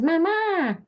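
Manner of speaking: high, mewing mama; cat saying mama. Pretend. The cat calling her, high mewing. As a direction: meow the word like the cat. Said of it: "Sometimes she says".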